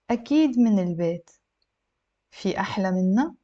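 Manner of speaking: careful model reading, pausing between sentences; measured, for language learners; a little slow. Shown as a demonstration. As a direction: read slowly with a questioning rise at the end. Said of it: "a little bit slow".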